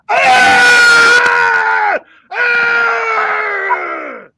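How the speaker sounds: huge high roaring scream; full-force roar. Shown as a demonstration. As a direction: let out a deafening, sustained cat roar. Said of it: "That's a roar".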